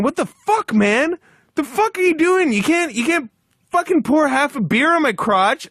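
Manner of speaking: mock the coworker screaming in outrage; retold yelling. Coworker soaked with beer yells at him, high angry shout. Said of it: "just started yelling at me".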